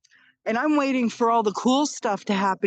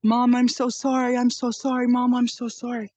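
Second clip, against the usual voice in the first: daughter pleading; 4 semitones higher than usual. Retold or imitated. Daughter over her mother's bed, higher pleading apology. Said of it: "and she's like".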